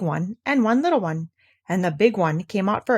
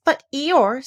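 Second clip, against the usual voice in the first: in distress; 6 semitones higher, 3 dB louder than usual. A narrator's reading. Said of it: "said Pooh in distress".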